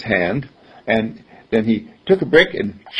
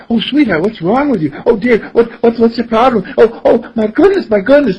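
high, louder, worried mom voice; fussing mother; 10 semitones higher, 4 dB louder than usual. Acted. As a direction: fret over the child in a high-pitched fluster.

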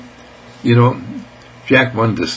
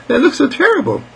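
put-on high-pitched little-girl protest; dismayed girl. Marilee repeats her objection to Raymond, high and dismayed.

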